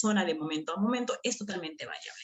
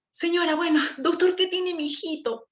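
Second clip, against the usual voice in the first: high, louder anxious mother asking; worried mother; 9 semitones higher, 3 dB louder than usual. Retold or imitated. Teacher voicing the anxious mother questioning the doctor. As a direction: press the doctor for news.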